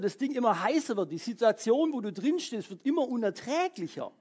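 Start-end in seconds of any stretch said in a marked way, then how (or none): none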